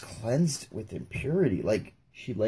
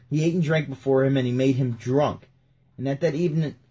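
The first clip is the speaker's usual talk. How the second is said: ordinary delivery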